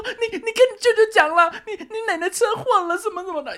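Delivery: very high-pitched mock sobbing; hammed-up tearful phone voice; imitating mother bawling. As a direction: wail it like a weeping mum breaking bad news. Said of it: "一直大哭".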